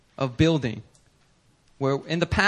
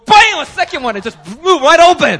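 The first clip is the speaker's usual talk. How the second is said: loud, high and thrilled; excited; 10 semitones higher, 12 dB louder than usual. Real, not acted. Pastor telling the church the door flew open, thrilled. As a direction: burst out with it.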